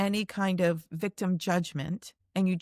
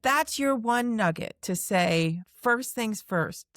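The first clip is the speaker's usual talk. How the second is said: normal talking voice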